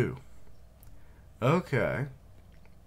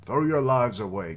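loud, higher rallying cry; rallying shout; 6 semitones higher, 7 dB louder than usual. Acted. Commander urging soldiers to sacrifice, shouted. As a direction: rouse the troops to die.

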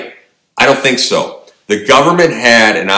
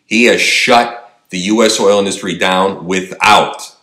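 ordinary delivery

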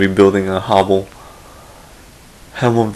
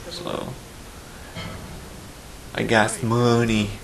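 ordinary talk

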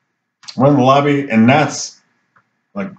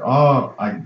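halting, rising higher toward the end; unsure answer; 4 semitones higher, slower than usual. Retold or imitated. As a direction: imitate a baffled man hedging his answer. Acts baffled man answering odd question.

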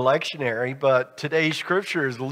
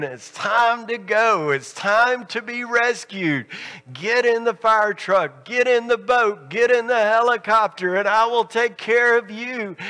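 urgent pleading call; 7 semitones higher than usual. Retold or imitated. God voiced calling people.

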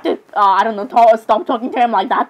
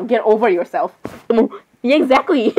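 ordinary talk

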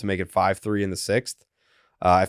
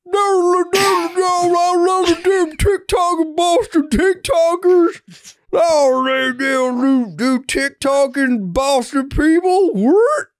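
very high, slow, louder mock Boston accent; Arkansas TikToker Boston accent; 5 dB louder, slower than usual. Mocking Arkansas creators faking Boston, very high mock accent. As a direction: squeal it like a fake Boston creator. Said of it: "that's what people from Boston sound like".

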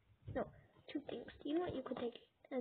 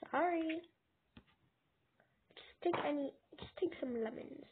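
usual speaking voice

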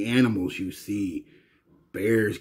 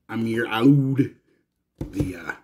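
ordinary talk, nothing marked

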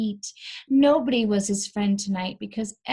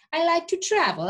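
cheerful; 9 semitones higher, 4 dB louder than usual. Acted. Tweedy explains.

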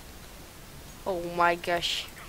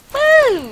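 shocked yell; 16 dB louder than usual. Real, not acted. Sudden in-game shock.

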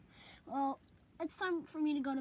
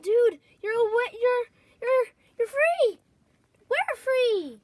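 higher, louder, thrilled cry; excited shout; 7 semitones higher, 9 dB louder than usual. Acted. Friend thrilled that they are both free. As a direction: shout it in joy.

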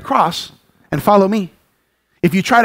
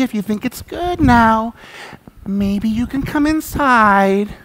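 mock permissive parent; 2 semitones lower, 4 dB quieter than usual. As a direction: plead with the toddler as if with an adult. Overly permissive parent speaking to toddler.

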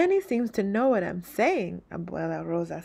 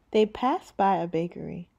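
plain reading voice

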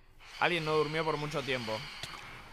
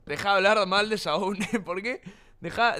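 normal talking voice

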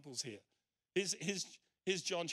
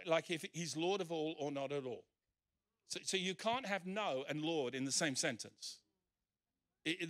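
usual speaking voice

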